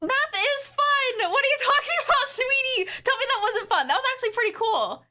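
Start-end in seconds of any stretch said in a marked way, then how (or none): 0.0-3.9 teasing delight. Pretend. Streamer teasing a doubter, high sing-song sweetness. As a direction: coo at a skeptic playfully.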